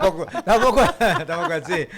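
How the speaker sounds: laughing protest. Real, not acted. Host laughs off being called grandpa, amused. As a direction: laugh it off in mock denial.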